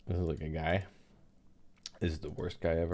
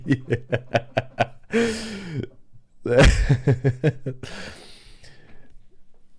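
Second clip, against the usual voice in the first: a real, long, loud laugh; can't stop laughing at his own bit; long loud laughter; 6 semitones higher, 9 dB louder than usual. Real, not acted. Laughing at his own fake accent on stream, loud and long.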